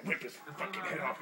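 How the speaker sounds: normal talking voice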